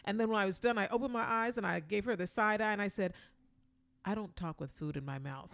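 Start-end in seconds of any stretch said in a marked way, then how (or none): 4.0-5.5 low, quiet, dry comeback; deadpan. Retold or imitated. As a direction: deliver it flat and cool.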